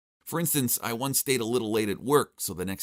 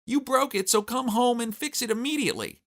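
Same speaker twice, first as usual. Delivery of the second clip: high-pitched mock shriek; acted angry nagging, pitched far up; shrieking wife; 3 dB louder than usual. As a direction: screech the demand down the phone. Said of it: "she was shrieking so loudly".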